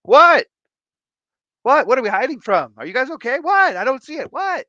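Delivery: high, loud squawking fish voices; baffled fish shouting in squeaky alarm; clueless fish. Acted. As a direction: yelp in high comic bewilderment. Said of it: "the original three are like".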